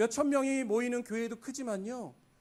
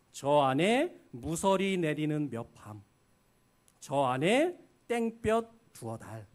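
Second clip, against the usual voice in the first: slow, measured poem reading; preacher reading a poem aloud slowly; poem recitation; 3 semitones lower, slower than usual. Acted. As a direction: recite each line with weight.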